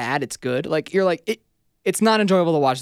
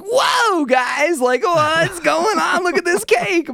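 much higher, louder hammed-up host gushing; overexcited host; 14 semitones higher, 5 dB louder, much slower than usual. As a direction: ham up an over-the-top TV host. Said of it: "the host is just so overly like".

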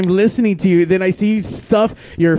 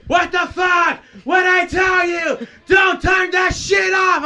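high, furious yell at the crew; angry yelling; slower than usual. Retold or imitated. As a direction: yell it like a grumpy boss. Angry sound guy yelling quoted.

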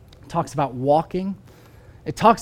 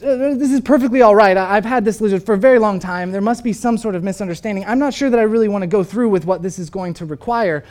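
higher and louder, stammering excuses; flustered protest; 5 semitones higher, 5 dB louder, faster than usual. Retold or imitated. Man pleading with the angel to spare his lizard, flustered. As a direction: talk fast to dodge what's coming. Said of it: "The man says".

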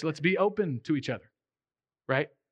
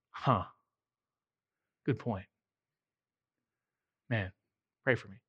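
low, soft, chastened; sheepish concession; 8 semitones lower, 5 dB quieter than usual. Retold or imitated. Pastor admitting his friend is right, low and sheepish. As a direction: take the rebuke humbly.

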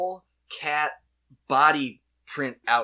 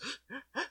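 gasp; 12 semitones higher, 11 dB quieter than usual. Retold or imitated. Baker's heart leaps.

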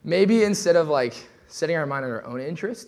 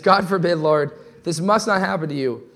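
"God forbid" loud and higher, pressing hard on it; urgent rebuke; 4 semitones higher, 7 dB louder than usual. Shown as a demonstration. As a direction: cry out "God forbid" as a protest. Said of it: "but he says".

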